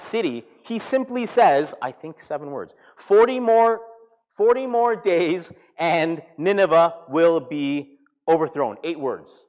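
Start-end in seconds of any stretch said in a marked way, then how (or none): 2.9-8.8 loud, slow declaration; booming, drawn-out prophet's warning; prophetic proclamation. Retold or imitated. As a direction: proclaim it to a whole city, word by word. Acting out Jonah's short sermon.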